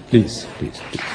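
whispered plea. Real, not acted. Exasperated plea to audience.

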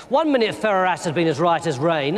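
ordinary delivery

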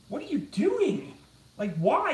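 high, slow baffled question. Retold or imitated. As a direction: ask it in disbelief. Reacting to weird streamers.

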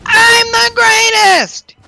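shouting. Acted. Game boast then panic.

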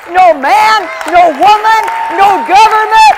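slow declaration building to loud, high cries; full-force preaching. Real, not acted. Preacher rousing the congregation about God's call, building to full force. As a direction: hammer each phrase out, rising to a shout.

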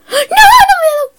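very high, loud cry of dismay; anguished shout. Real, not acted. Gamer just killed, shouting in dismay. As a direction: wail at the sudden loss.

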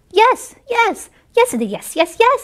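bright, high, bouncy yeses; eager deer. Acted. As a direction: chirp each yes up high. Deer's excited repeated yes.

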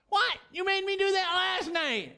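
a stone idol complaining it was forced down; indignant Dagon. Acted. Dagon protests to God.

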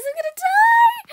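high loud yell of doom; panicked yell. Real, not acted. Player about to die, high panicked yell. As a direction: scream it in panic.

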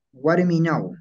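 "Guarminau" ending in a clear -nau glide; "Guarminau" closing on -au; final AU diphthong. Shown as a demonstration. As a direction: say "Guarminau" with a to u at the end. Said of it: "en la pronunciación".

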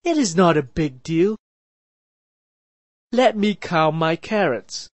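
a mild shout from far away; shouting from afar. A narrator's reading. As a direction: call over the distance. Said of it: "the eighth brother shouted from far away".

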